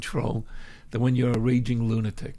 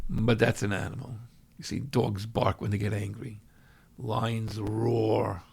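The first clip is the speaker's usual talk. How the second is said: ordinary delivery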